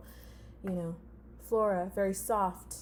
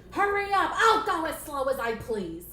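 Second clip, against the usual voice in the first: loud, high quarrelling; hammed-up puppet squabble; angry yelling; 6 semitones higher, 6 dB louder than usual. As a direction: yell it back, fed up. Boss and assistant snap loudly.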